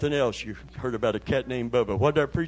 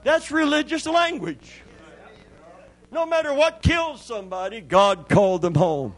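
high, loud fiery preacher's cry; preaching at full force; 9 semitones higher, 5 dB louder than usual. Acted. Preacher crying out to the church, fiery and loud. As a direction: thunder it at the congregation.

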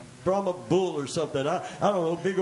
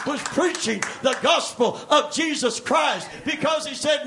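loud shouted preaching; preaching at full force; 10 semitones higher, 8 dB louder than usual. Acted. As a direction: preach it out to the rafters.

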